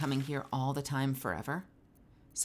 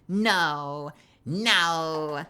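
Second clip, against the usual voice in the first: usual speaking voice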